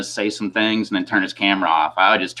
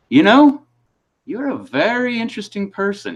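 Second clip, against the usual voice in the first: much higher imitation of the officer; officer's wondering verdict; 11 semitones higher than usual. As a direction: pitch it way up, mock-intrigued.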